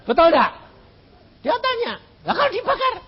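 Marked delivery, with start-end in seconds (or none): 2.2-2.9 high anxious question; worried caller. Retold or imitated. Caller fears being burned: high worried question. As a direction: ask fearfully what if they burn.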